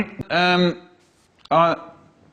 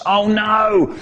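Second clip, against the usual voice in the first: higher, louder mock panic; mock dread; 6 semitones higher, 4 dB louder than usual. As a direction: gasp it like a kid in trouble. Dread at dad's anger.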